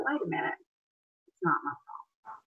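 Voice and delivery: voicing a battered woman's quiet realization, almost breathed; hushed realization. Therapist voicing a battered woman realizing it's not her fault, hushed. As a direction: drop to a hushed aside as the penny drops.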